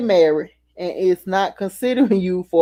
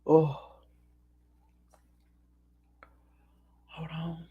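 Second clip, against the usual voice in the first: dry heaving; 12 dB quieter, slower than usual. Real, not acted. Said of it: "I'm feeling very nauseous".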